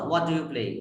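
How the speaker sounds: "What do you play?" low, with a soft "do"; soft low question. Shown as a demonstration. Teacher repeats the soft wh-question tune. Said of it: "this do sound is soft sound".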